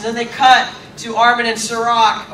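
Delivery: ordinary talk, nothing marked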